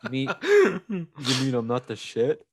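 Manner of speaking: disbelieving mock voice; a hint of a stunned fighter; laughing mock disbelief; shocked Rocky. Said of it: "Rocky was like".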